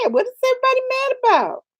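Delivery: high, quick baffled question; acted confusion. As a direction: ask it fast, voice shooting up. Retold confusion after zoning out.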